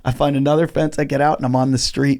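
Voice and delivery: usual speaking voice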